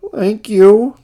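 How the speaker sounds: high, sorrowful little thanks; high character voice with sadness; sad. A narrator's reading. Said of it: "Ernie said sadly".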